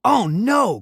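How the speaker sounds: high, loud cry of alarm; sudden panicked Oh no; frightened cry. A narrator's reading. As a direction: yelp it as the crash comes. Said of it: "cried Percy".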